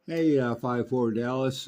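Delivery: normal talking voice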